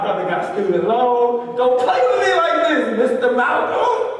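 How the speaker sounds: loud, high mock outrage; hammed-up, wounded protest at full voice; indignant pleading. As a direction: plead with comic desperation. Comic pleading with the interviewer.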